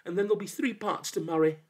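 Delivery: high, fast threat; threatening. A narrator's reading. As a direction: warn the demon boldly and quickly. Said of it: "he cried".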